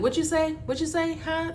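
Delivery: high, puzzled asking; mock confused listener. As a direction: mimic someone who can't follow. Mocking people who don't understand her.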